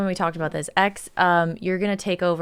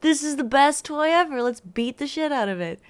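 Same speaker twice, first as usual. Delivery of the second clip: high, loud hammed-up cat voice; voicing excited cats; 7 semitones higher, 3 dB louder than usual. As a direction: play the cats gleefully wanting to wreck a toy. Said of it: "they're like".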